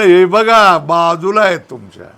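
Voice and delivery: loud हे बघा pointing. Retold or imitated. Sage loudly telling the seeker to look beside him. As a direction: call out he is beside you. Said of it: "त्याने सांगितलं".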